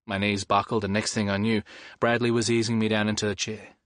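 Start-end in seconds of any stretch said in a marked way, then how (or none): none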